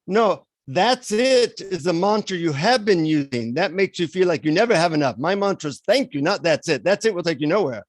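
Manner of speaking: put-on guru's quick correction in an Indian accent. Guru correcting his disappointed seeker, Indian accent. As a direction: scold lightly with an Indian accent. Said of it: "in an Indian accent".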